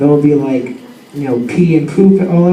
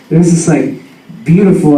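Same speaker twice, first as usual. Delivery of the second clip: usual speaking voice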